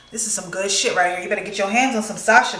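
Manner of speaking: ordinary delivery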